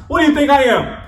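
mock indignant. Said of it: "but I'm like".

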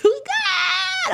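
mother mimicking her daughter's scream on the ride; mock child scream. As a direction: shriek it like a panicking little girl. Scared child retold in story.

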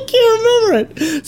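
laughing. Real, not acted. Forgetting the ritual line, laughing.

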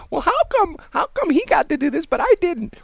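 put-on very high pleading child whine; whiny kid voice. Kid protesting to mom about his brother, high and whiny. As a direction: squeak it like a sulky kid. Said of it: "You know".

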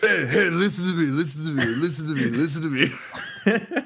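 mock insistent. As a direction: badger him, half laughing. Imitating himself pestering the guy.

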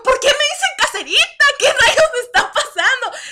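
high, loud bewildered question; baffled outburst. Retold or imitated. In the market, asking why everyone calls her caserita, baffled. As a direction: sound lost and puzzled. Retold confusion at vendors calling.

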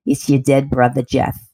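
lightly voiced New York brother, low; a hint of New York accent, joking. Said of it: "He had a New York accent".

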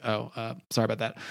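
put-on a caught-out boy apologizing under his breath; sheepish apology.

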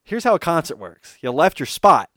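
mock patronizing lecture, slowed right down; condescending lecture. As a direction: spell out concert rules to a child. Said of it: "And then he's like".

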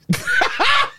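high, loud burst of laughter; shrieking incredulous laugh. Real, not acted. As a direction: laugh out in disbelief. Laughing at the threat.

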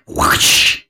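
unvoiced rush of air for a swinging spoon; voiceless swat noise; imitated whoosh. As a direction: make a swishing hit sound effect.

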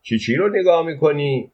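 mildly acted calling-out question. A narrator's reading. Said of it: "با صدای بلند گفت".

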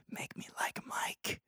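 slow, hushed whisper. Retold or imitated. Kid whispering a wish to his magic shoes. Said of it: "He whispers to the shoes".

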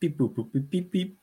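high playful beep boop sounds; robot beeps. Pretend. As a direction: answer like a calculating computer. Echoing computer math joke.

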